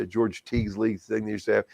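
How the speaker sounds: normal talking voice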